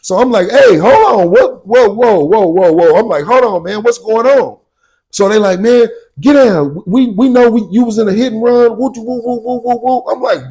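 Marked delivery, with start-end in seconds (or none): 0.5-3.0 high, loud alarmed whoa; startled protest. Retold or imitated. He tells the police to hold on, alarmed and loud. His retold reaction to police. 3.2-4.6 confused protest. Retold or imitated. His retold reaction to police. 5.6-8.8 retold police order. Police order him down over a hit and run, loud. 8.8-10.0 high wailing woo-woo siren sound; siren imitation. He imitates the police siren, high wailing woos.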